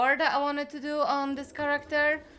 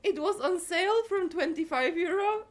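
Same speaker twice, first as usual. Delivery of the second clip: normal talking voice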